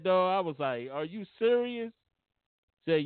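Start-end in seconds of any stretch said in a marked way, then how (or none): 0.9-1.9 higher, incredulous retold question; a touch of mock outrage in the retelling; retold disbelief. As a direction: ask it like you can't believe it, mildly. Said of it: "I was so mad".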